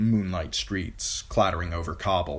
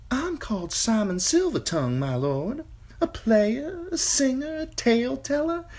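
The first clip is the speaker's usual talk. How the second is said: high, showy singer introducing himself; boastful singer. A narrator's reading. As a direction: sell yourself grandly.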